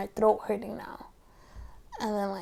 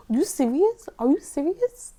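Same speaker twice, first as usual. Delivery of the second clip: high, louder incredulous question; can't believe what you just heard; shocked disbelief; 6 semitones higher, 5 dB louder than usual. Retold or imitated. Hearing the old boss quit.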